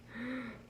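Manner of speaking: sharp startled intake of breath; frightened gasp. Acted. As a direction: gasp as if a twig just snapped. Pete hears loud crack.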